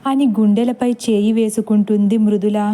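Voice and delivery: ordinary delivery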